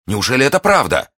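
high, loud, incredulous delight; overjoyed exclamation. A narrator's reading. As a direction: exclaim it as a lover who can hardly believe it. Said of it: "воскликнул он". (восторженно, не веря счастью)

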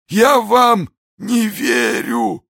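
very high, slow, strained shout; dying defiance. A narrator's reading. Dying soldier's last words to D'Artagnan, agonised shout. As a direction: drag the words out in agony. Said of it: "сказал солдат и в страшных страданиях".

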